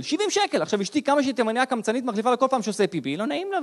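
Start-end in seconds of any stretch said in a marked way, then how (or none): 0.0-0.6 higher, indignant repeat; שבעים שקל repeated high and indignant; 1 outraged repeat. Shown as a demonstration. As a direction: say the price again in disbelief.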